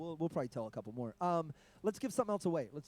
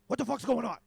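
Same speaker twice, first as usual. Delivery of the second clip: imitating an irritated heckler, a bit higher and louder; mock angry heckle; 6 semitones higher, 7 dB louder than usual. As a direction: play the annoyed comic snapping at the crowd. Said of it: "And he goes".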